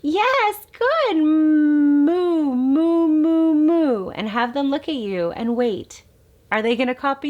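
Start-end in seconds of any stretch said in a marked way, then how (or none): 0.0-4.1 high, loud delighted moo moo; thrilled reply to a baby's mm; excited praise. Shown as a demonstration. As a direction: cheer and expand the baby's sound. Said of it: "take what they are doing and expand on it".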